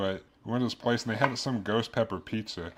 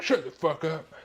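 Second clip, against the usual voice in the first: annoyed friend; 6 semitones higher, 3 dB louder than usual. Retold or imitated. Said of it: "And he's like".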